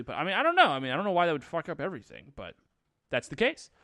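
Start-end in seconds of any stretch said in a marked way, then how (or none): none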